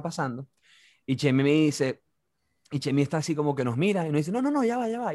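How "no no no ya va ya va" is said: high, quick protest. Retold or imitated. Chemi protesting to the angry guy, high. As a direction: wave them off, flustered.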